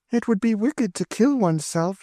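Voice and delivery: put-on high, anxious girlish pleading; pleading young girl. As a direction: beg her to run away with you. Emmeline's high voice.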